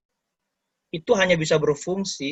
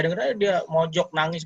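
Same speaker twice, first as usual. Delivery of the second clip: ordinary delivery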